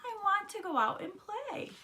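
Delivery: very high, pleading whine; crying protest. A narrator's reading. Froggy begging his mother to go out, high whine. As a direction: plead high without shouting. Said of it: "cried Froggy".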